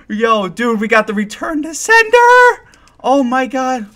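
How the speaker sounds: high excited yelling with laughter; thrilled disbelief. Real, not acted. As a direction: lose it over a huge win. Reacting to legendary pull.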